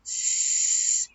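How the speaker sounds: "S" as a sustained hiss; "S" hissed, not the letter name; isolated S sound. Shown as a demonstration. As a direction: hold "S" as sss. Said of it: "It was a".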